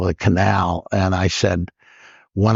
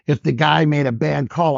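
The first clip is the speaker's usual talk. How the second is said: ordinary delivery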